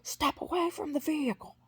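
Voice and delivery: imitated police bullhorn, very high; high-pitched mock loudhailer command. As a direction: mimic a cop ordering through a megaphone. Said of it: "and the bullhorn's like".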